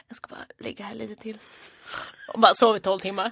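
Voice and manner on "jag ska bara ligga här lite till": whispered, half asleep; sleepy whisper. Retold or imitated. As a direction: murmur it like you're barely awake. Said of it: "vände jag på mig och bara". (sömnig viskning)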